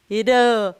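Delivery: "rã" with a closed, nasal vowel; som fechado. Shown as a demonstration. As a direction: say "rã" as an isolated closed syllable. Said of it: "o que deixa o som dessa palavra fechado". ("rã" com som fechado e nasal)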